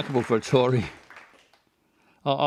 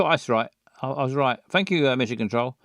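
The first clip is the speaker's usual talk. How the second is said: ordinary talk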